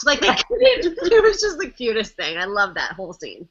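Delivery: ordinary talk, nothing marked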